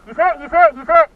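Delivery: loud, high urgent callout; man down shout. Real, not acted. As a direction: yell it so the team hears. Teammate hit, calling it out.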